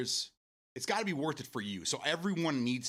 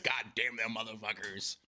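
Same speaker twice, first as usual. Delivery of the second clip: imitating Bubba. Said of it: "I can just hear his voice right now".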